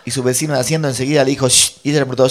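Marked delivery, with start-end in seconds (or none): 1.5-1.8 quick loud shush; imitated shushing. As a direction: cut her off with a shush. Vecino manda callar a ella. (chistido rápido y fuerte)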